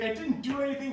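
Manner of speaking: high-pitched mock wail of protest; crying child. Wrongly blamed daughter protests to her father, mock crying. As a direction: imitate a little kid crying at being blamed. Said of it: "she's crying".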